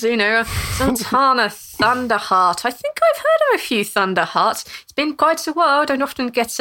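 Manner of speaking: lightly voiced old neighbour; old lady. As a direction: muse fondly on old acquaintances. Cassandra chats with the dwarf.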